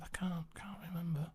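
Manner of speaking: a soft breathy whisper; whispered reply. Retold or imitated. Patient whispers his evasive answer back to the doctor. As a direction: whisper it as if embarrassed.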